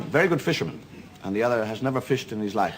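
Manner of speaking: normal talking voice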